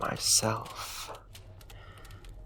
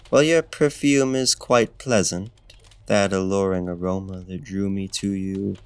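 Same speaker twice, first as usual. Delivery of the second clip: ordinary talk, nothing marked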